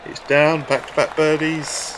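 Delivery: excited. Real, not acted. Celebrating a holed putt.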